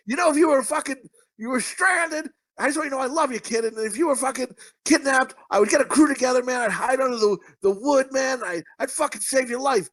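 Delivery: high-pitched, earnest retold pledge; hammed-up buddy confession; mock heartfelt declaration. Retold heartfelt speech to friend.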